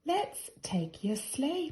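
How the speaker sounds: a little bear's excited plan; eager cry. A narrator's reading. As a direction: call it out as a bright idea. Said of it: "Paddington cried out".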